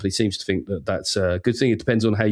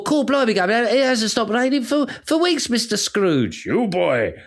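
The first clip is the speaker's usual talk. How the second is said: high, hammed-up Dickensian London accent; mock Victorian Londoner, pitched right up; 10 semitones higher than usual. Comedian mimicking the eighteen seventies to Scrooge, hammed-up Victorian accent. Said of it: "You're gonna do the accent?".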